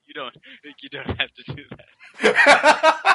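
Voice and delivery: laughing protest. Retold or imitated. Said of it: "You're like".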